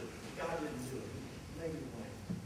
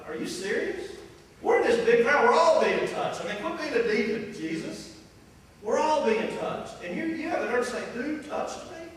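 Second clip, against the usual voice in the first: louder, higher, incredulous protest; exasperated disciples; 6 semitones higher, 6 dB louder than usual. Retold or imitated. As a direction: scoff at him in disbelief.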